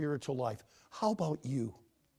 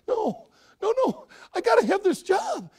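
high, loud desperate begging; frantic clown pleading for his job; 8 dB louder than usual. Acted. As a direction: beg the boss, voice climbing high.

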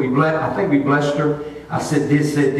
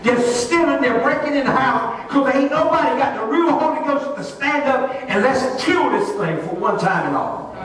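raised, emphatic sermon voice; fervent preaching; 6 semitones higher than usual. Real, not acted. Preacher urging Rocky Mount to hear God, fervent preaching. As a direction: press the message on the congregation.

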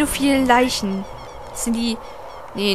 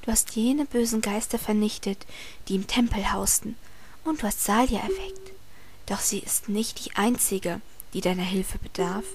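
whispered reading; 3 semitones lower, 7 dB quieter than usual. Acted. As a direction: read it like a secret. Reads game text in whispery voice.

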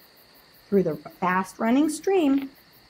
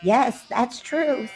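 slow, resigned yes that's true; despairing agreement; 4 semitones higher, much slower than usual. A narrator's reading. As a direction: agree sadly, with no hope. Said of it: "shaking their heads in despair".

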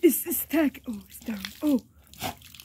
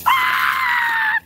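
very high-pitched scream; playful shriek. Pretend. Sudden outcry during playful chase.

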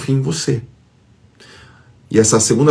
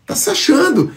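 reclamando; 10 semitones higher, slower than usual. Retold or imitated. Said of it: "a pessoa fala".

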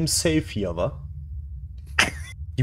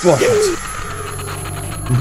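sharp shocked exhale; startled gasp. Real, not acted. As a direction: jump out of your skin. Startled by ghost event.

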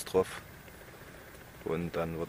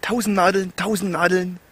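mit zusammengebissenen Zähnen; 10 semitones higher, 6 dB louder than usual. Real, not acted. Stinging wound, told bite teeth.

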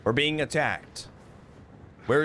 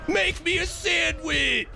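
long, high-pitched holler; yelled order; 11 semitones higher than usual. Acted. Gamer taunting an opponent, high drawn-out yell. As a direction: yell it slowly, drawing out the words. Yelling order during game.

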